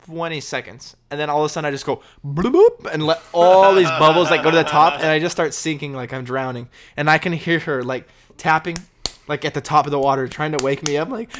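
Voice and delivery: ordinary delivery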